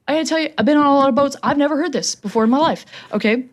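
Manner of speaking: ordinary talk, nothing marked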